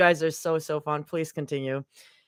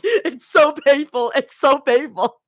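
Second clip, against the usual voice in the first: higher, louder mock-pained voice; hammed-up pained cry; mock agony; 6 semitones higher, 6 dB louder than usual. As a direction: wail it like a suffering cartoon object. Joke voice for mugs thinking.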